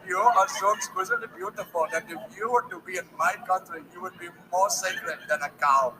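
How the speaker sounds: put-on a suitor talks dirty to a woman in an Indian accent. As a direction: seductive Indian suitor, half laughing. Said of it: "because I'm Indian".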